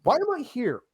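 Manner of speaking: high, baffled question; mock-bewildered, voice way up; retold bafflement.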